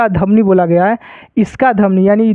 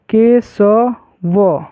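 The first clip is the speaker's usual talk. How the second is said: very slow, drawn-out "Keshav"; syllable by syllable; much slower than usual. Shown as a demonstration. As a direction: stretch "Keshav" into separate syllables, ke-so-vo. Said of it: "उच्चारण में ना ह लगा". ("केशव" को तोड़कर, बहुत धीरे)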